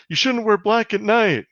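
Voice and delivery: high-pitched mock scolding; mocking imitation. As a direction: mimic the blaming driver in a high voice. Mocking the driver's victim-blaming excuse.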